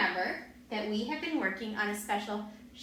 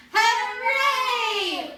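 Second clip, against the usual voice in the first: shouted; 10 semitones higher, 9 dB louder than usual. Shown as a demonstration. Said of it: "shout a bit louder".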